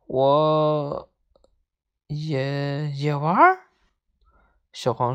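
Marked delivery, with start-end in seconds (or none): 0.1-3.7 halting, hesitant question rising at the end; bashful. A narrator's reading. Parrot surprised by an invitation to play, bashful. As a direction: falter shyly, with pauses between words. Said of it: "接着扭捏地说".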